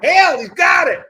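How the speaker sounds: loud, high Texan holler; Texan shout. Pretend. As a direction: holler it like a cowboy. Texan version of the quote.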